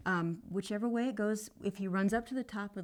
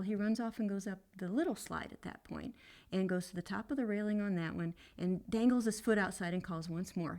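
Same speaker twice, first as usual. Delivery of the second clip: normal talking voice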